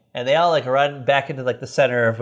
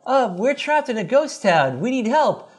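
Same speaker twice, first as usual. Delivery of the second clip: higher, earnest plea; Greg voiced; 9 semitones higher than usual. Greg calling for help on the phone, higher earnest voice. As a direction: ask for help like a stranded teen. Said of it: "And Greg's like".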